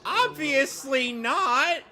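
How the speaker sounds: high, loud, drawn-out outburst; incredulous shout, slow and high; indignant retort. Real, not acted. Indignant retort about withheld evidence.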